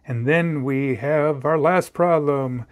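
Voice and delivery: slow, eerie ghostly moan. Pretend. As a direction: drag it out like a friendly ghost. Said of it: "I don't know why I'm talking like a ghost".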